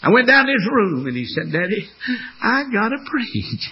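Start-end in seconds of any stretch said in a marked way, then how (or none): 1.5-3.7 a hint of an eager little son; child's voice. Son telling his daddy, light child voice.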